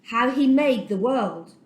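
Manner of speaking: ordinary delivery, nothing marked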